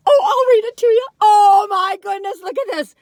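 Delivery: loud, high burst of delight. Real, not acted. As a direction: gush over the cookie. Delighted at the cookie.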